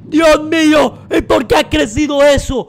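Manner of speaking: startled shout. Real, not acted. Scary mutation appears on screen.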